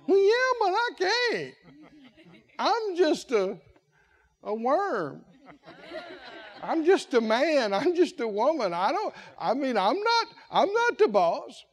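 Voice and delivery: hammed-up reluctant employee; mock whining excuse. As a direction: drag out the excuses pitifully.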